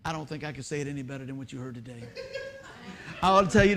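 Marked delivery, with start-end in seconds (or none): 0.0-2.0 low, quick, quiet admission; understated humble excuse. Retold or imitated. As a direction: slip the excuse out softly and fast. Retells humble excuse to congregation.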